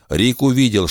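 plain reading voice

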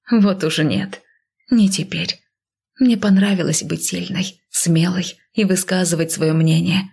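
usual speaking voice

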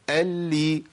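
اللي with a held L opening into "li"; doubled lam with kasra. Shown as a demonstration. Teacher models same timing, held L in اللي released to i. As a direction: hold the L in اللي, then release to i. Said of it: "نفس الزمن".

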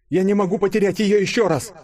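high, loud pleading outcry; desperate cry. A narrator's reading. Денис fearing to lose Юля again, desperate high cry. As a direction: shout it as if about to lose her. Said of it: "хотелось закричать Денису".

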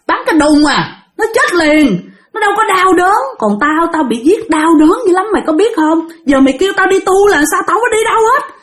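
high-pitched aggrieved protest; indignant refusal. Retold or imitated. Spirit protesting her painful death to the master, indignant. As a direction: act out a bitter, stubborn spirit.

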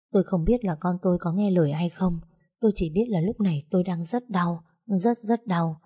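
plain reading voice

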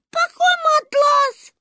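put-on a small child jolting awake, piping up; startled little girl. Little Sonechka asks uncle about the mattress: high startled toddler voice. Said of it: "встрепенулась Сонечка".

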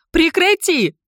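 high, loud shout to stop; screaming. Acted. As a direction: cry out to break up a fight. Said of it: "закричала Мара". (пронзительный крик)

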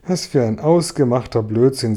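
ordinary delivery, nothing marked

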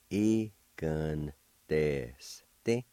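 very slow "Giganteste", broken into separate syllables. Shown as a demonstration. Teacher pronouncing word number one for learners, very slowly in syllables. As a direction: stretch out "Giganteste" piece by piece. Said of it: "like this".

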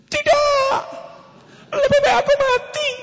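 high, wailing sinetron daughter; melodramatic daughter. Acted. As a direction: overplay the tragic refusal. Daughter refuses arranged match. (putri sinetron meratap tinggi)

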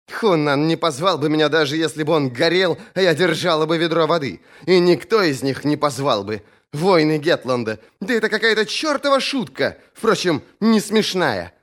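indignant, high-pitched female character voice; bitter Thorn. A narrator's reading. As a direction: rant bitterly, pitch climbing.

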